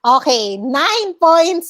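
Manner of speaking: ordinary talk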